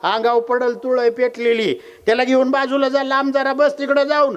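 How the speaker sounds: acted alarmed neighbour; urgent warning. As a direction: press her to get away from the fire. Neighbour urges escape from fire.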